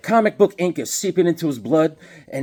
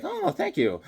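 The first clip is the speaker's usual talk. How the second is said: mom's thanks; 12 semitones higher, 5 dB quieter than usual. Retold or imitated. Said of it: "she's like".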